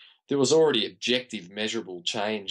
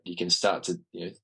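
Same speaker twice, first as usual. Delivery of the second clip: ordinary delivery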